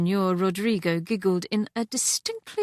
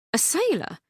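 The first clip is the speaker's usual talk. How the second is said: 'A sailor?' pitched high, amused surprise; surprised echo; 9 semitones higher than usual. Acted. Catherine echoes Celeste, 'A sailor?' high in surprise.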